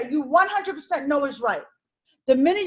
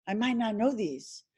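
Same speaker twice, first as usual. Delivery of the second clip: soft, calm reassurance to yourself; calm self-talk; 7 semitones lower, 11 dB quieter than usual. Pretend. Student calming herself during the exam, soft and steady.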